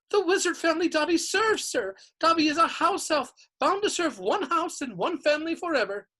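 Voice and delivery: high-pitched reverent house elf; adoring house elf. A narrator's reading. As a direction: gush like a devoted little servant. Dobby explains himself.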